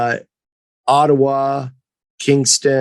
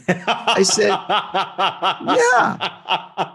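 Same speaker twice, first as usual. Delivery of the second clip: laughing through the words; 4 semitones higher, 4 dB quieter, much slower than usual. Real, not acted. Telling the host the story while laughing. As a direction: chuckle as you tell it.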